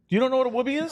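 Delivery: "You don't know what a whoopee is?" high and fast; fast, high-pitched amazed question; incredulous. Real, not acted. Incredulous at no idea.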